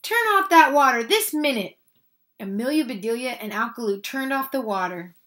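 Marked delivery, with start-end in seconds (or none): 0.0-1.8 high, loud command; shouted order. A narrator's reading. Missus Rogers orders the hose turned off, shouting. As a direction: order it sternly at raised volume. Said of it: "shouted Missus Rogers".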